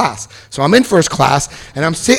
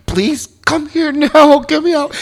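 much higher, slow pleading; desperate plea; slower than usual. Retold or imitated. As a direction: beg mom to hurry, childlike. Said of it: "I'm like".

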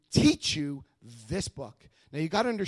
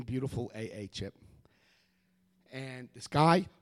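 usual speaking voice

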